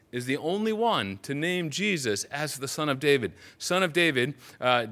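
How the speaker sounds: usual speaking voice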